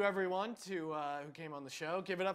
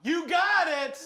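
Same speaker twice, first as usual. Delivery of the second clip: loud, higher, excited host shout; 8 semitones higher, 8 dB louder, slower than usual. Real, not acted. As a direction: announce the winner with a shout. Quiz host announces winner.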